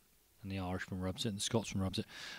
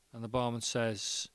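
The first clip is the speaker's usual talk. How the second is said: ordinary talk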